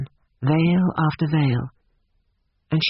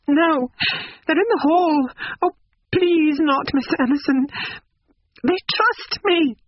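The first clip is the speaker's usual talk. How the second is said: put-on very high, anxious young woman; distressed pleading; 13 semitones higher than usual. A narrator's reading. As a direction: plead desperately in a high-pitched character voice. Distressed Lucy pleads with old man.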